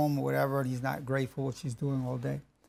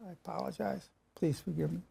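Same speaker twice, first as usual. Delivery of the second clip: much softer, sincere and soothing; gentle apology; 3 semitones higher, 7 dB quieter than usual. Shown as a demonstration. Apologizing to an upset spouse, soft and soothing. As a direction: say it softly to calm an upset partner.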